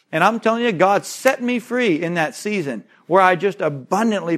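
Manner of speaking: loud, high, driving sermon voice; fiery preaching. Acted. Pastor preaching to his church at full force. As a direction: preach it hard to the congregation.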